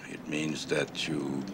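trailing into a low, soft mumble; soft, slow, barely audible; too quiet to hear. Real, not acted. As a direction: say it under your breath, slowly. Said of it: "Could you speak up".

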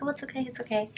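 calm the frightened dog; soothing. Retold or imitated. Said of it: "grab him and be like".

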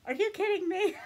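high, loud shocked cry; shocked disbelief. Retold or imitated. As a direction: blurt it out in disbelief. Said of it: "And I was like".

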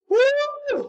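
very high, long 'woo'; celebrating whoop. Real, not acted. Streamer cheering ten K win, excited whoop. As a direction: let out a high victory whoop.

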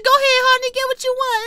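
very high, squealed imitation; mocking her. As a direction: mimic her in an exaggerated high voice. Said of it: "Y'all know what she says?".

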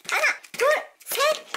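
high loud cheering count; excited counting. Real, not acted. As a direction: cheer each kick.

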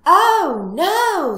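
high, very loud wail; storybook scream of dismay; screaming in alarm. Acted. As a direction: cry out like a terrified piglet. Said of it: "screamed the piglet".

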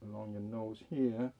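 muffled behind mask; speaking through mask fabric; through a face mask. Real, not acted. Seen 1: Blue surgical mask covers nose and mouth throughout; reference frames unmasked.

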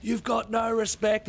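high, slow whiny jeer; mock drunk yob. Neighbour mocking the trespassers, high whiny jeer. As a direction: drag it out like an offended lout. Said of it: "they just turned and go".

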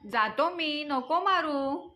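mildly whining surrender; pleading. A narrator's reading. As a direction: beg to be let off, stretching the words. Boy begs mother to stop beating.

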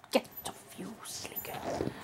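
soft whispered telling-off; whispered scolding. Real, not acted. Owner telling puppy off, whispered. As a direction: hiss it under your breath.

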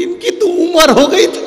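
very high, mock-wailing excuse; hammed-up wife backing out in a squeal; panicky widow. Widow dodging death, comic wail. (घबराई पत्नी का ऊँचा नकली विलाप)

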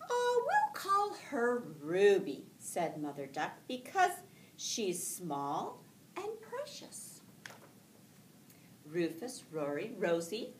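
lively storybook read-aloud with voiced ducks; reading to children. Teacher reading a picture book aloud to her class, lively and gentle.

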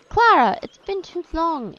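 a loud, high happy call of her name; light up at seeing her; delighted greeting. Acted. Andreas greeting Clara after a long absence, loud and high with joy.

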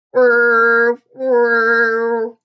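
loud, sustained mechanical whir; loud monotone machine hum; robot whirring noise. A narrator's reading. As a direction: whir like a toy robot on one level note. Imitating the marching robot's noise.

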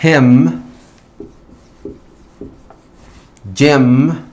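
hem, gem, symbol, math, typical, lyric with Y as short "eh"; short E sound. Shown as a demonstration. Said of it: "es un short E sound". (hem, gem, symbol, math, typical, lyric con "e" corta)

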